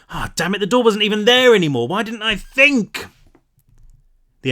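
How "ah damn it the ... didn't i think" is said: self-directed anger. A narrator's reading. Pan realizes her mistake, frustrated outburst. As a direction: kick yourself out loud.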